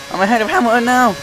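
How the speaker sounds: voice cracking. Real, not acted. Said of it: "Oh, voice crack there".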